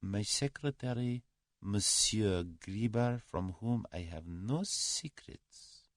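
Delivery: soft, slow Russian dignitary; suave Russian. A narrator's reading. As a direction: introduce him with slow, quiet formality.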